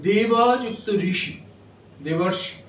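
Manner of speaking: "দেব যুক্ত ঋষি" said slowly, then joined as "দেবর্ষি"; slow "দেব যুক্ত ঋষি", then merge the parts into "দেবর্ষি"; sandhi demo. Shown as a demonstration. Lecturer showing students a Sanskrit sandhi. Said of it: "অ এর সঙ্গে রি যোগ হলে সেটা অর হয়ে যেত".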